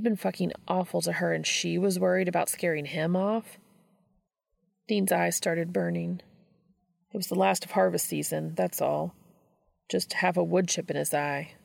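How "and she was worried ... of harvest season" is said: ordinary delivery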